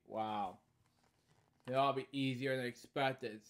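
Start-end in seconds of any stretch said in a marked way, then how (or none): none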